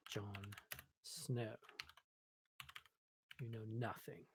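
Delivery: low, soft attempt at a British accent; bad British accent. Developer typing a Game of Thrones line, bad British accent. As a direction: attempt a British accent, quietly and half-jokingly. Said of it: "that's probably a terrible British accent".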